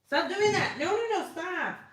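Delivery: high, loud stop-it cry; sharp high scolding. Real, not acted. To the cat at her yarn, high loud scolding.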